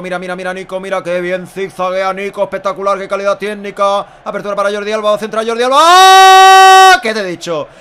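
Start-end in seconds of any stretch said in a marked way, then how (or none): 5.8-7.0 sudden excited cry; excited yell. Real, not acted. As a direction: burst out at the chance. Anticipating cross, scream.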